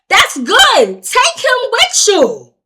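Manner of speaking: high, loud, insistent yell; heated re-enacted outburst; angry demand. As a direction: shout it as a fed-up dare. Retold argument with officer.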